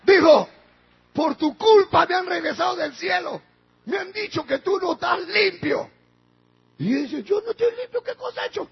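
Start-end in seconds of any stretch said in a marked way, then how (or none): 1.1-5.9 loud, high-pitched rebuke; accusing shout. Retold or imitated. Wife back from heaven accusing her husband, shouted. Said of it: "gritó". 7.2-8.7 high-pitched indignant protest; protesting husband. Retold or imitated. Accused husband answering his wife, protesting in a high voice.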